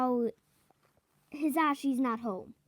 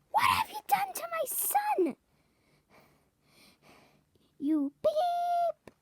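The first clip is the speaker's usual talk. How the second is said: high, shrill furious shout; snapping at the doctor. Acted. Mother snaps at the doctor who changed her son, shrieking high. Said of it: "she snaps at Doctor Wu".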